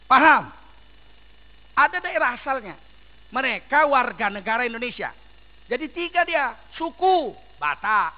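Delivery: loud, emphatic sermon delivery; fiery preacher hammering each point; forceful preaching. Acted. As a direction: preach it hard to the crowd.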